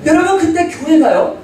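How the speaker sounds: high, loud preaching shout; fervent preaching. Acted. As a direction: hammer the point home to the church. Preaching peak to congregation.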